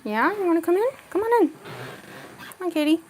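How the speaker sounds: high, sing-song pet voice coaxing the cat in; cooing to a cat. Real, not acted. Calling the cat into the room.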